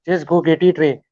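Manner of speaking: imitating a cricketer's pep talk; Sachin Tendulkar. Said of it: "Just as Sachin Tendulkar will say".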